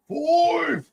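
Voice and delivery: high shouted count; one-word wrestler's holler; King Kong Bundy. Acted. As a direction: bellow it out high and drawn-out. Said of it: "That was your King Kong Bundy".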